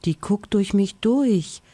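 ordinary delivery

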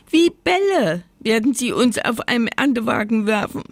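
high-pitched indignant potato; lightly hammed-up complaint; scolding. A narrator's reading. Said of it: "schimpfte eine lange, dünne Kartoffel".

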